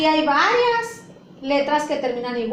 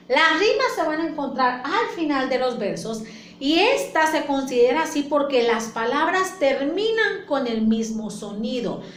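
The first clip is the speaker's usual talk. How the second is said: ordinary delivery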